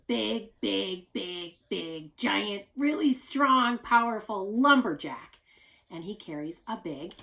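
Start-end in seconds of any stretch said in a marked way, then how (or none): 0.1-5.4 big and strong. Shown as a demonstration. Teacher making the lumberjack sound huge to children, loud. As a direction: puff it up to sound mighty.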